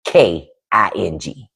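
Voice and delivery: normal talking voice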